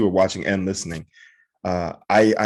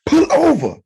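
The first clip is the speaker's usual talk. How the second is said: very high, louder mock command; 5 dB louder than usual. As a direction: bark the challenge in a squeaky-high put-on voice.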